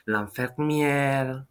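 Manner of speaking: l'infirmière very slowly, stressing the è; slow French l'infirmière. Shown as a demonstration. Teacher repeats it slowly for students. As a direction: say l'infirmière at half speed. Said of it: "I put an accent on the first E".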